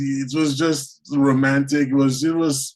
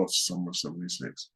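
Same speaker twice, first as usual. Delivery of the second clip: wistful sigh; 6 semitones lower, 12 dB quieter than usual. Retold or imitated. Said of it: "we all say that".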